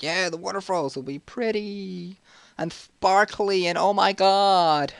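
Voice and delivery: high, slow, drawn-out gushing; mock gushing excitement. Kid told of seeing waterfalls, hammed-up slow, high gushing. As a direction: gush with exaggerated wonder, stretching the words. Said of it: "you should see the look I just got".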